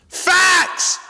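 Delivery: shouts it out high and loud; emphatic shout. Real, not acted. Cohost shouting agreement at the host's point, loud. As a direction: slam down agreement like a verdict.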